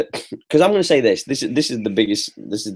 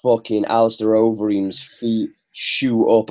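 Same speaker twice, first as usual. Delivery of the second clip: ordinary delivery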